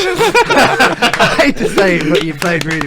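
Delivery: laughing through the words. Real, not acted. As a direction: laugh while admitting it. Grudging praise of rival team.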